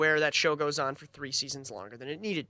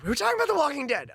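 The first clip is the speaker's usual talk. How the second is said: fast, higher announcer-style restart; mock host restart; 11 semitones higher, much faster than usual. Shown as a demonstration. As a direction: reset the show with fake pep. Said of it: "repeated with a mock restart".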